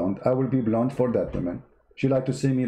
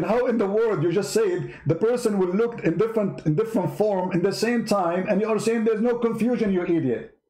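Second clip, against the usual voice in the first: raised, incredulous rant calling him an idiot; exasperated rant; 7 semitones higher than usual. Real, not acted. Host ranting at the preacher for claiming there's no confusion, exasperated. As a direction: let the disbelief boil over.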